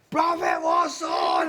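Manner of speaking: very slow, loud deep shout. Retold or imitated. Classmates shout at the teacher, slow and loud. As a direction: bellow it out across the classroom.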